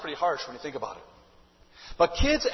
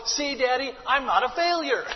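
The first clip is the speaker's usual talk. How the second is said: put-on defensive kid; 6 semitones higher, 4 dB louder than usual. Child voiced answering father.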